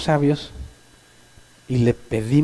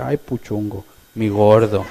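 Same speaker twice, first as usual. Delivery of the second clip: mock lovey-dovey; 4 semitones lower, slower than usual. Lovesick girl texting boyfriend.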